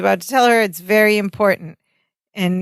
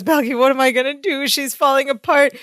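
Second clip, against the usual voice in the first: high, sobbing plea, lightly hammed-up; mock sobbing; 6 semitones higher than usual. Larry sobbing to Balki about his fiancée, mock tearful. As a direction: fake a sob through the words. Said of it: "While he's sobbing".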